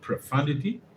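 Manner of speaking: "Profundity?" softly, rising at the end as a check; "Profundity" with "fun" in the middle, unsure and rising; questioning pronunciation. Shown as a demonstration. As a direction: try "Profundity" as pro-FUN-di-ty, asking. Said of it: "how do you pronounce profundity like that?".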